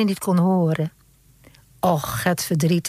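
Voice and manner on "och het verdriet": ordinary delivery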